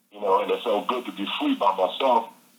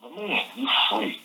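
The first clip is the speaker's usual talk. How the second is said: excited self-talk; 11 semitones higher, 3 dB quieter than usual. Retold or imitated. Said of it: "and I said".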